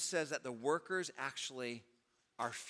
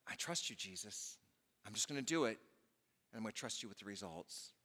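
quiet prayer; 6 semitones lower, 6 dB quieter than usual. Acted.

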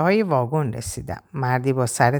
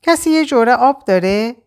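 higher and louder, calling out; raised voice; 7 semitones higher, 4 dB louder than usual. Acted. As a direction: call across the wagon for water. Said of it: "تون صدام بالا رفت". (با صدای بلندتر و بالاتر)

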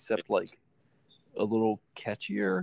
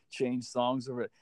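ordinary delivery

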